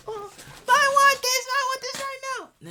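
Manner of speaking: put-on high, slow, loud spoiled-kid whine; mock little kid begging loudly; whiny child. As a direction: whine like a bratty toddler demanding a toy.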